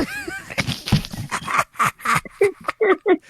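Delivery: high, long burst of laughter; helpless laughter. Real, not acted. Laughing at butt stuff.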